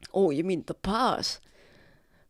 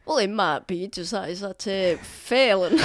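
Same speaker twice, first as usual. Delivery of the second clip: ordinary delivery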